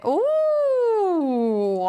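long, loud, high swooping oh; drawn-out oh. Real, not acted. Player spotting a level-up, drawn-out surprised oh. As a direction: let a surprised oh swoop up and hang. Sudden realisation while gaming.

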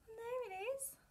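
excited gasp of discovery; delighted surprise. Pretend. Finding the boot, gasps.

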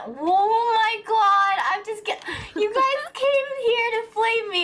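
high, loud laughing rant; raging gamer. Real, not acted. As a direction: rant in mock outrage. Accuses viewers of flaming her.

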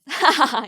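short demo "ha-ha-ha" laugh; demonstrated audible laugh. Shown as a demonstration. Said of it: "이렇게 소리를 내며 웃는". (소리 내어 웃는 시범)

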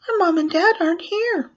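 put-on high-pitched little kid voice; children's answer. Children tell the clown at the door, high child voice.

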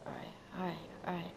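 hushed whisper to himself; whispered self-talk. Real, not acted. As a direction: breathe it out almost silently. Whispering to himself while gaming.

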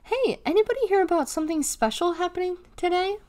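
high, sugary-sweet question; sweetly. A narrator's reading. Deli asks the stunned table, sweetly. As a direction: ask it sweetly, pitched well up. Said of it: "Deli said sweetly".

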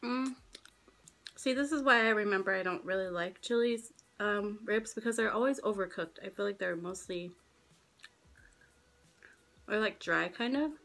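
speaking between bites of rib; talking while chewing. Real, not acted. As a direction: mouth partly full of food. Seen 0: Holds rib at mouth; licks lips at 0.3, bites rib 8.0-8.6.

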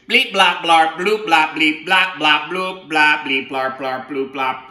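bouncy robot bleeps fading down; robot language. Acted. Octopus reading the book in robot language. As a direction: babble robot noises, winding down. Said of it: "in robot language".

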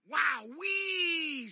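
high, loud delighted gasp of wonder; amazed wow. Acted. Pom Pom amazed by his superhero friends. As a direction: squeal with amazement.

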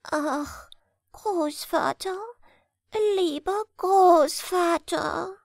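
high, slow pleading little hare; pleading grandson. Acted. Little hare coaxing his grandfather, high and pleading. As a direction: coax the grandfather sweetly.

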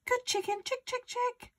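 very high little hen-calling voice; coaxing. A narrator's reading. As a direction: praise and lure the hen.

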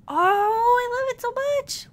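very high, slow squeal of love; squealing adoration; gushing delight. Real, not acted. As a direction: gush it out high, loud and drawn-out. Delight at underwater find.

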